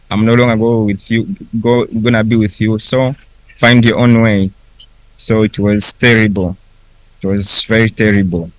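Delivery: ordinary talk, nothing marked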